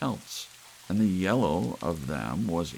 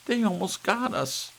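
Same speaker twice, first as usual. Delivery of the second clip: much higher, shaken cry; frightened wife; 4 dB louder than usual. Acted. Wife to husband just after escaping lions, frightened. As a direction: blurt it out, still terrified.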